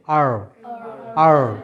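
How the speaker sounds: low আর, tongue on palate, slight tremble; American R. Shown as a demonstration. Teacher demonstrates folded tongue, low vibrating আর. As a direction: curl the tongue and let the r in আর vibrate. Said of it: "জিহ্বাটা তালুতে লাগবে এবং একটু কম্পিত হবে".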